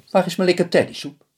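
a high, demanding little man asking for soup; husband's voice. Acted.

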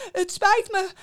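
high, loud wailed apology; wailing. A narrator's reading. Innkeeper apologizing while beaten, wailing. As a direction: moan it out in pain. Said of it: "kermde de herbergier".

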